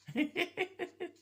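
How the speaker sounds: high-pitched burst of laughter; high giggle. Real, not acted. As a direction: giggle at your own joke. Laughs at own joke.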